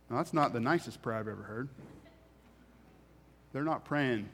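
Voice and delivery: ordinary talk, nothing marked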